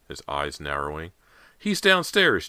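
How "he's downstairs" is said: put-on high, loud, excited boy; excited shout. A narrator's reading. Boy sure his puppy is downstairs, shouting excitedly. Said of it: "James shouted excitedly".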